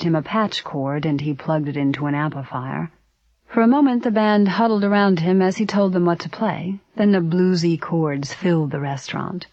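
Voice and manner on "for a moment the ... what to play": ordinary delivery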